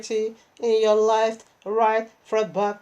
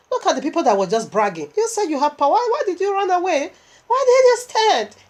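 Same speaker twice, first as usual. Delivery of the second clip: higher and higher, taunting the fleeing demons; mocking taunt; 8 semitones higher than usual. Preacher taunting demons who ran away, mocking rising cry. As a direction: jeer at them, rising to a high cry. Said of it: "I begin to laugh in that vision".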